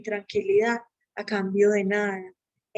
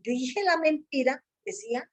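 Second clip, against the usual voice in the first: normal talking voice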